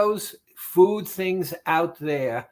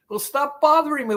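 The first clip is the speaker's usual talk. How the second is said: high, fast, irritated brush-off; annoyed reply; 10 semitones higher, much faster than usual. Retold or imitated. Client brushing off the craving voice, high and fast. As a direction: snap back at the nagging voice. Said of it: "The voice said".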